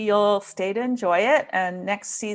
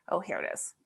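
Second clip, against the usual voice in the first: ordinary delivery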